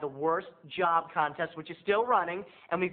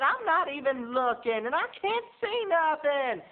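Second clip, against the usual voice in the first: squeaky high voice, slow on the last line; high mock Tammy voice; 10 semitones higher, 4 dB louder than usual. Teller imitating his friend's carefree denial, high and squeaky. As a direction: mimic a woman's breezy denial in a high voice. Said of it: "And she said".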